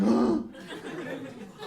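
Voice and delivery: breathy mock gasp; imitated crowd gasp. The gym turning on them, a mock gasp.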